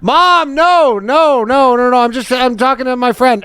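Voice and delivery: high, flustered denial; caught by mom. Retold or imitated. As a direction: cover up to your mom.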